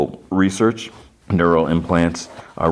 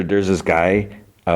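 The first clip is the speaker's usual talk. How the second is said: ordinary talk, nothing marked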